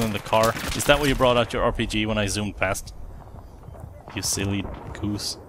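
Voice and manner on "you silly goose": soft, slow, mock-fond scolding; teasing. Real, not acted. Gamer teasing an enemy who missed him, softly and slowly.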